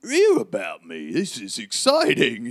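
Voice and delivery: thrilled fan-like outburst; Hagrid, excited. Acted. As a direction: gush with delight in a put-on accent. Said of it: "like, giggle".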